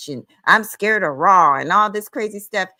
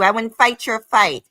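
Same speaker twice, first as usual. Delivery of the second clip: ordinary delivery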